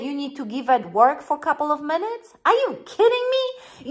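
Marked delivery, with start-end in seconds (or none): 2.4-3.9 are you kidding me, much higher; playful disbelief at a silly fear; high, mock-astonished; incredulous. Coach scoffing at a listener's fear.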